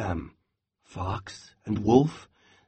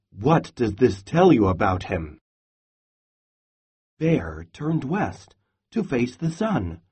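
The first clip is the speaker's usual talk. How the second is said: ordinary delivery, nothing marked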